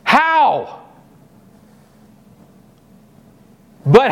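high, pleading questions to God; a young woman asking God how it can happen; pleading Mary. Acted. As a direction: beg God to explain how, in disbelief. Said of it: "she asks the next natural question".

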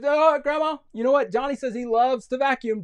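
put-on high, loud, sugary little-girl voice; volunteer someone else with false sweetness; scheming sister. Jenny volunteering Johnny to Grandma, high put-on voice.

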